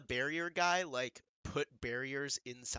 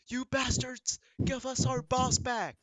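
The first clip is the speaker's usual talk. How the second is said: higher, furious henchman cry; angry shout; 10 semitones higher, 4 dB louder, slower than usual. Acted. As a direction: yell it at the captors.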